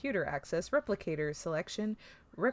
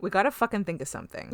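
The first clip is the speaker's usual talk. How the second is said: fast, pressed urgency; urgent Troy; 3 semitones higher, 4 dB louder, much faster than usual. Retold or imitated. To friends, voicing Troy urging a plan fast. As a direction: rush it, time is running out. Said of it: "Troy's like".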